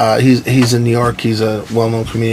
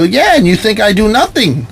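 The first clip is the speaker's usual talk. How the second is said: higher, indignant retort; indignant comeback; 9 semitones higher than usual. Retold or imitated. Husband defending his work to his wife, indignant.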